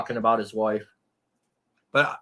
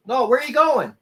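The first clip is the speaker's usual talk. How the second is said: calling after kitten; 12 semitones higher, 6 dB louder than usual. Real, not acted.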